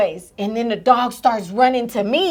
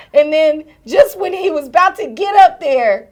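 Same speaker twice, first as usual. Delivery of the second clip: ordinary delivery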